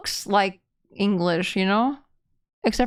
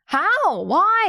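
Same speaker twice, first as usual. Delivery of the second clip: higher, louder baffled cries; exasperated disbelief; baffled outburst; 8 semitones higher, 4 dB louder than usual. Real, not acted. Baffled at leet speak.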